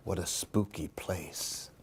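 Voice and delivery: usual speaking voice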